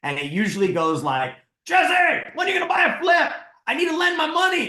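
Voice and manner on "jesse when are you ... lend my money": very high, loud imitation shout; hammed-up mentor impatience. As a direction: holler it as a pestering mentor, breaking into laughter. Imitates mentor's usual phone greeting.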